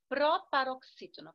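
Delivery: ordinary delivery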